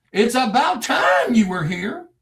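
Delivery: high, drawn-out exclamation; retold exclamation. Man at his door to long-awaited investigators, retold high and emphatic. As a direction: repeat it emphatically, high and slow. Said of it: "Come in, sit down".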